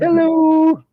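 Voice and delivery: high drawn-out hello; Seinfeld hello. Pretend. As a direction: echo the hello playfully. Answering the hello bit.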